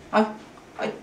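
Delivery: halting, with gaps between false starts; stammering. A narrator's reading. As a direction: stumble hesitantly into an excuse. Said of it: "he stammered".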